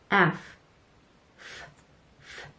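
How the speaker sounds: F, F, F as a voiceless breathy hiss through the teeth; F sound demo. Shown as a demonstration. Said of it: "이렇게 발음하세요".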